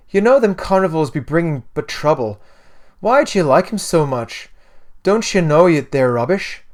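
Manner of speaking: put-on protesting Irish-accented lad; weird Irish accent. A narrator's reading. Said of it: "a weird Irish accent".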